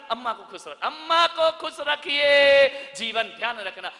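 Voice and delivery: normal talking voice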